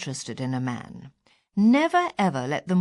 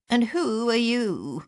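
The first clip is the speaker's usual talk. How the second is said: put-on slow, higher, wavering old-lady voice; mock senile wavery question; wavery dotty voice; 5 semitones higher, slower than usual. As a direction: play a confused dotty old woman. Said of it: "in a sort of wavery, dotty voice".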